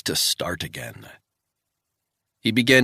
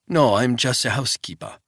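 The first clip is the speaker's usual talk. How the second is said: put-on old Swiss woman, higher and louder, thick Swiss accent; wary old housekeeper in a thick Swiss accent; old housekeeper questioning a stranger, thick Swiss accent; 5 semitones higher, 3 dB louder than usual. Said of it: "in a thick Swiss accent".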